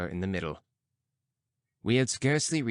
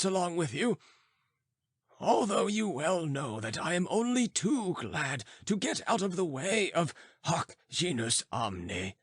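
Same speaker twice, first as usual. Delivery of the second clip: put-on stern old philosopher; 8 semitones higher than usual. Philosopher scolding the students for firing pistols. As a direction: rebuke them like a cranky old master. Philosopher addresses distant friend.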